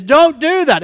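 dad's high, forceful command; loud scolding. Retold or imitated. Dad home from work to kid, loud scolding. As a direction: burst in loudly with the rule.

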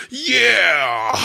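loud, high mock moan of pleasure; mock climax groan; ecstatic moan. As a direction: let out a hammed-up loud yeah. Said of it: "you're like".

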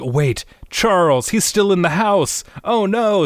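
high-pitched dawning panic; playful rising alarm; mock alarmed realization. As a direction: gasp out the realization, hammed-up. Acts sudden alarmed realization.

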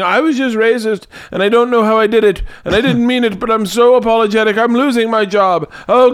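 a panicked guy scrambling to take it back; backtracking interviewer. Retold or imitated. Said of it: "you could hear his backtracking".

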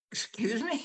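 sharp high "excuse me?" of disbelief; mock stunned question; retold disbelief. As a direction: act like you can't believe what you just heard. Incredulous reply to adjuster in story.

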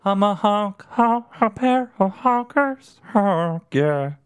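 high warbly crooner voice, honking; silly [name] impression. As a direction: croon it high and wobbly like a goose. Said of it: "That's [name] singing the Honkers tune".

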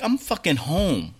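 heated mock tirade at the manager; loud and fed up, swearing at her; retold rant. Customer ranting to the KFC manager on the phone, heated. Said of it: "I was like".

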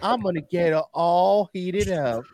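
hammed-up Southern dandy drawl, long-drawn exclamations; Lindsey Graham. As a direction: stretch it out like a swooning Southern gentleman. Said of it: "Southern Dandy, Lindsey Graham".

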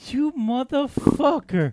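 mock outrage. Real, not acted. Teased about being fifty.

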